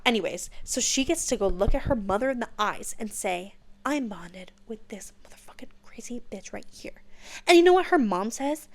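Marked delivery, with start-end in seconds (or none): 3.8-7.0 conspiratorial hushed boast; gleeful whisper. Retold or imitated. Violet telling her mother off, whispered boast. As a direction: whisper it like a savage secret.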